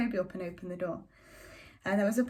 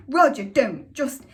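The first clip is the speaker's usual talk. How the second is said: sharp, much louder cry to stop him; mother shouting; 5 semitones higher, 10 dB louder, slower than usual. A narrator's reading. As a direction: cut him off loudly, higher. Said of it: "And Mother shouted".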